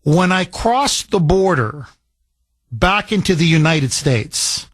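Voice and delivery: usual speaking voice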